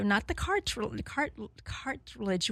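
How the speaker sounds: false starts on "cartilage", breaking off mid-word and retrying; tripping over pronunciation. Real, not acted. Host struggling to say a word to listeners, stumbling over "cartilage". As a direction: stumble twice on "cartri-, cart-" before getting out "cartilage". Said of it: "I can't even speak".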